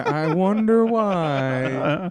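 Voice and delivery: loud, very drawn-out mock puzzlement; mock wondering. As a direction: stretch it out slowly, playing at cluelessness. Joking reply to déjà vu remark.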